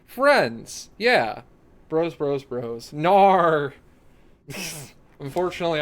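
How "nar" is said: loud, drawn-out Australian "no"; mock Aussie accent, punched louder. Streamer mimicking an Australian friend's no, Australian accent. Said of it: "I'm not Australian like Kix".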